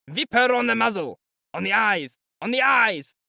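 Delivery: high, loud vicious yelling; cruel shout. A narrator's reading. Mikolka orders the lads to whip the mare, vicious shout. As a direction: bark the orders with brutal glee. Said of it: "Mikolka shouted".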